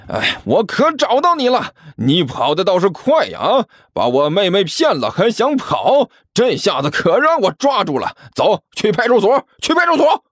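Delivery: high-pitched public accusation, rising to a yell; flustered loud shouting. Acted. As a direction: make a scene, calling out for all to hear. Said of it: "同时是气急败坏地大声喊道".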